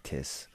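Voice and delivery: Tiss with a hard t, no th sound; imitating Scottish. Imitating a Scottish guest, Tiss for this. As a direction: drop the h, say Tiss. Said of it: "They don't pronounce the H".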